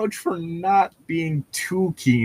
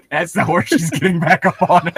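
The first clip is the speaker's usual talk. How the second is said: laughing; 12 semitones higher than usual. Real, not acted. Laughing at shark-horse picture.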